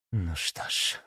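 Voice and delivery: soft breathy sigh of words; exhaled. Acted. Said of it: "выдохнула она". (на выдохе, тихо)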